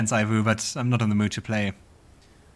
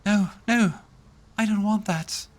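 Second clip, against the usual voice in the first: much higher, upset denial; horrified succubus; 9 semitones higher than usual. Acted. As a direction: recoil from her own desire. Succubus rejecting her nature.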